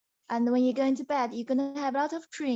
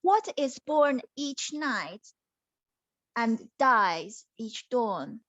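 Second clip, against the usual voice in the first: slow, drawn-out riddle; slow riddle reading; slower than usual. Acted. Princess posing her riddle, slow and mysterious. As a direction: read the riddle slowly and mysteriously. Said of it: "The first question is".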